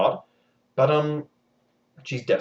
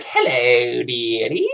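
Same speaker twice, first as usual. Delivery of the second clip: much higher, slow sing-song imp voice; higher wheedling trickster voice; creepy little man; 8 semitones higher, 6 dB louder, slower than usual. Acted. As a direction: coo it like a sly goblin. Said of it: "strange little man shows up".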